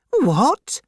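high, startled question; crying out. A narrator's reading. Miss Pross hears her brother has business here, crying out astonished. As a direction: shoot it up high in disbelief. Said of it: "cried Miss Pross".

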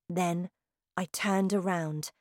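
ordinary delivery, nothing marked

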